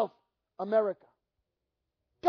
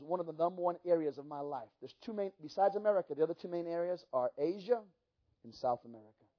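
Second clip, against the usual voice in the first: a lower, softer retold voice; low quiet Mike; 7 semitones lower, 9 dB quieter than usual. Retelling Mike Bickle's words.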